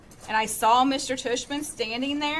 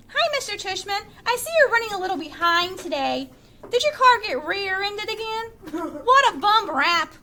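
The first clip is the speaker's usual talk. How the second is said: fake old lady voice; 7 semitones higher, 3 dB louder than usual. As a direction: put on a fussy old lady voice. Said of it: "He was using a fake old lady voice".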